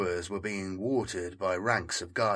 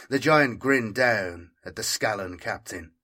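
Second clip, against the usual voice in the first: ordinary delivery, nothing marked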